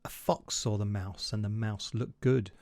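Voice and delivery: low, soft storytelling voice, unhurried; storybook narration. A narrator's reading. Reading The Gruffalo to viewers, soft low narration. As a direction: read it like a bedtime story.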